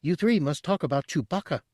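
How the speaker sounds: very high squeak; squeaky Chadrafan. A narrator's reading. Tooty stopping Bosk from shooting, a high squeak. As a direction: squeak it out in alarm.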